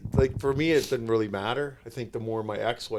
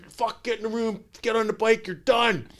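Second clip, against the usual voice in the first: higher mock yell at Mac; retold coach yell; 9 semitones higher than usual. Coach ordering a drunk player to the bike, mock yell. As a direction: bark it like an annoyed coach. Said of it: "He's yelling at Mac".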